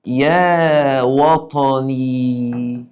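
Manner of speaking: يا وطني held out very long and slow; stretched call. Shown as a demonstration. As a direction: sing out the call as if reciting. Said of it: "يقول لك". (نداء ممدود طويل)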